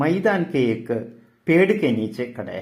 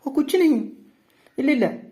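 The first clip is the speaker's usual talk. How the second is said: much higher-pitched, as if amused; lightly acted laughing; 14 semitones higher than usual. Bela denies crying to Sahil, acting as if laughing. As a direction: brush it off high, feigning a laugh. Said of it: "ചിരിച്ചുകൊണ്ട്".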